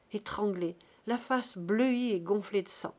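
ordinary delivery

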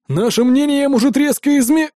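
high, forceful biker voice; threaten, then break off mid-word; threatening gang boss. Acted. Biker boss threatens captive at gunpoint, high-pitched threat cut short.

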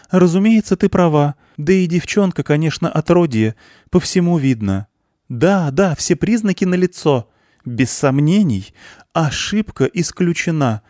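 high, loud ladies agreeing eagerly; relieved clamour. A narrator's reading. Ladies rushing to agree with the director, relieved clamour. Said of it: "загомонили облегчённо дамы".

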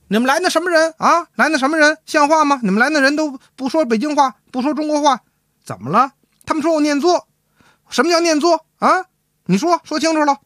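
mock-scold like an indignant wife. Wife berates husband about his visitors, high-pitched indignant nagging. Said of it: "可跟他翻车了".

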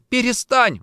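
high, loud anguished cry; distraught outburst; tearful plea. Acted. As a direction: beg him to stop, nearly sobbing. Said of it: "Варя плакала теперь навзрыд".

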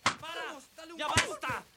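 pained yelling. Acted. Being hit with a stick.

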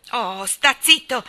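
loud, slow furious yell; angry shout. A narrator's reading. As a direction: yell at it to shut up. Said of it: "gridò rabbiosamente Jane".

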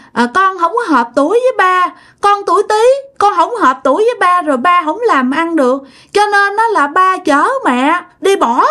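put-on high, aggrieved little child complaining; a dead child's voice pouring out grievances. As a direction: speak as a hurt small child scolding her parents. Possessed woman speaks as dead child.

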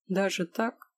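usual speaking voice

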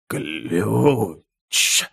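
long, choked, dying croak; squeezed out in agony. A narrator's reading. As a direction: force the word out through convulsions. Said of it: "выдавил Виттер, сотрясаясь в агонии".

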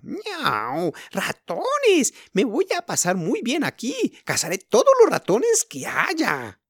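high, playful little kitten voice, slowing at the end; squeaky kitten delighted by the mice. A narrator's reading. Kitten alone at night, thrilled to find mice: high and playful. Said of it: "se dijo la gatita".

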